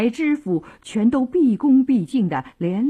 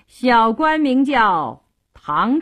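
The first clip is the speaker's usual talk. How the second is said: dry sneering chuckle, then slow words; acted cold laugh into a drawn-out introduction; 3 semitones higher, 4 dB louder, slower than usual. A narrator's reading. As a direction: chuckle coolly, then announce yourself slowly. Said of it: "唐城冷笑道".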